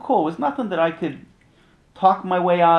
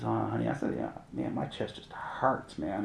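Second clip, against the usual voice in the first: downplay the pain to his wife; quiet pained reply; 5 semitones lower, 8 dB quieter than usual. Retold or imitated. Husband softly tells wife his chest hurts.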